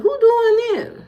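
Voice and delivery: high indignant. Real, not acted. Indignant reply to chat.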